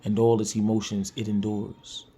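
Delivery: soft, hushed poetry reading over music; quiet spoken word reading; spoken word poem. Acted. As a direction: read it low and intimate, like a confession. Host reads his own poem.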